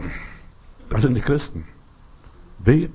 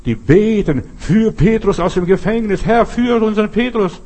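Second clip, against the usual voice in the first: raised, urgent prayer cry, high and loud; preacher acting out desperate shouted prayer; crying out to God; 6 semitones higher, 3 dB louder than usual. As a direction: call out like a congregation praying at full voice. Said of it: "die schreien und rufen zu Gott".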